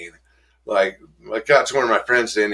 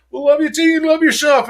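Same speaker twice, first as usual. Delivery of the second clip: much higher, louder, sing-song; mock gushing hugger; 6 dB louder than usual. Hugging member answers newcomer, gushing much higher and louder. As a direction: gush like the eager hugger. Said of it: "And, and he's like".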